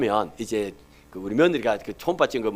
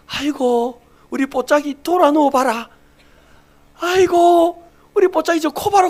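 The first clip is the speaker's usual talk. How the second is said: much higher, cooing voice with laughs; doting grandpa baby talk; 13 semitones higher, 7 dB louder than usual. Retold or imitated. Grandfather cooing at the ultrasound of his unborn grandchild, high doting baby talk. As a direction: coo over the baby like a besotted grandfather. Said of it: "제가 꼭 미친 사람 같으면 그러고 있습니다".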